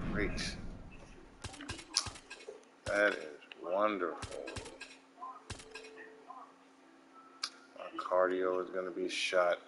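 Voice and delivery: low, very soft sarcastic sulk; glum resignation. Real, not acted. Gamer with no choice but cardio, glum resignation. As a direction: mutter it quietly, deflated.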